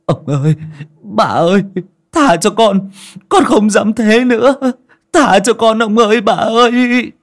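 very high, loud desperate begging; stammering plea. Acted. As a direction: beg for your life in terror. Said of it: "lắp bắp cầu xin".

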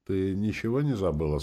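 ordinary delivery, nothing marked

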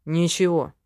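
curt, irritated one-word retort; mildly testy dismissal; snappish. A narrator's reading. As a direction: snap it back, annoyed. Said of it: "огрызнулся Ленар".

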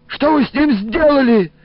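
high, loud, accusing outburst; angry attack. A narrator's reading. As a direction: lay into him in a high-pitched voice. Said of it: "набросилась на Илариона Бабале". (гневно набрасывается, высоко и громко)

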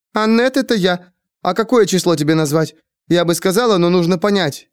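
put-on high, anxious childlike chatter; fretful Annette. Annette frets over a question about her age, voiced high. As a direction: babble like a frightened simple girl.

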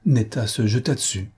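usual speaking voice

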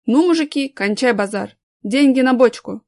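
loud gruff order; gruff boss demand. Acted. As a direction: bark out the demand. Gang boss demands money.

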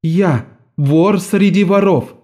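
slow, high, defiant answer; defiant girl. A narrator's reading. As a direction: answer boldly, proud thief. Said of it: "ответила Наска".

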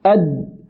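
أد stopped firmly on the d; sound held shut. Shown as a demonstration. Teacher demonstrating shiddah on dal, sound blocked. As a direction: trap the voice on the د of أد. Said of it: "حبس الصوت".

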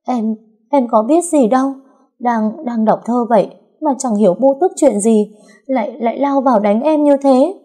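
high, stuttering fake distress; stammering. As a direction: stammer out the story like a frightened victim. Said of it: "Thấy Trúc lắp bắp".

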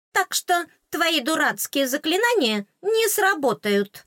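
high, squeaky, scornful fairy; the tiny fairy huffing in contempt; high little fairy sneering; indignant fairy. A narrator's reading. Indignant fairy voiced in story.

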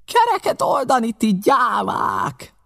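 high, drawn-out shout; scornful stretched yell; outraged cry. A narrator's reading. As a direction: shame the cowards at full voice. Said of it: "kiáltott föl Pew".

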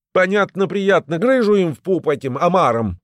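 high, loud, heated retort; snapping back. A narrator's reading. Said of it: "опять огрызнулся Грыжа".

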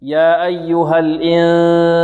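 long nasal hum on the n of "الإنسان"; slow chant, sustain the nasal "ن"; full ghunna. Shown as a demonstration. Teacher demonstrating complete ghunna, slow nasal recitation. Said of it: "وهنا غنة كاملة".

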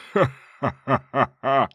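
slow booming evil demon laugh; demonic laugh. Acted. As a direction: guffaw like a monster. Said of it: "заржал демон".

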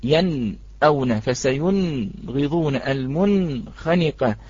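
ينأون فسينغضون المنخنقة said very slowly, with a break cut after each nun; wrong pause before letter. Shown as a demonstration. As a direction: stop dead on the nun, then go on, syllable by syllable. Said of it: "لا نقول مثلًا".

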